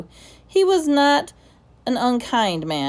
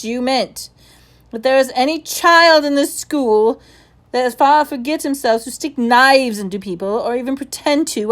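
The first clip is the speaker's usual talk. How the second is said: stern interrogation; 5 semitones higher, 7 dB louder than usual. Acted. Headmaster interrogating a pupil.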